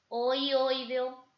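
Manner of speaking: "ioideo" said very slowly, every vowel clear; slow spelled-out vowels. Shown as a demonstration. Teacher sounding out a triphthong example slowly. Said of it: "Por ejemplo".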